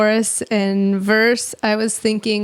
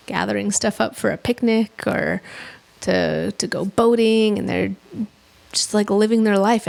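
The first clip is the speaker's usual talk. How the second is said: normal talking voice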